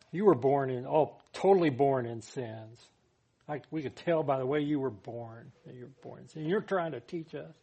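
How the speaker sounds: loud, haughty scolding of a sinner; spiritual arrogance. Acted. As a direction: talk down to him like you're above him. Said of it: "So they had this spiritual arrogance".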